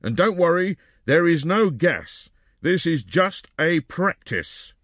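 man giving orders. A narrator's reading. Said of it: "he ordered".

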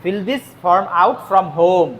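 slow dictation. Shown as a demonstration. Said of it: "slow dictation to students".

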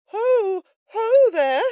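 very high, loud hail; tremulous cry. A narrator's reading. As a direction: call out nervously from the rear. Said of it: "cried a tremulous voice in the rear".

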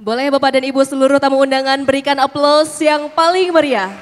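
fast, loud call for applause; loud announcer call. Real, not acted. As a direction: pump up the audience. (seruan pembawa acara yang lantang)

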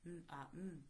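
lightly voiced unbothered hum; calm Cooper. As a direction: mildly mimic a totally calm kid. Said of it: "that's how calm he is".

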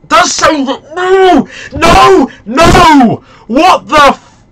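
loud, high repeated no; real loud despairing cry; anguished shouting. Real, not acted. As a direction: howl at the missed penalty. Penalty saved, despair.

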